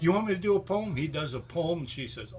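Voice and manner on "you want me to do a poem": high, eager and pleased with himself; eager offer. Retold or imitated. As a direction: offer it like a star. David offering to perform.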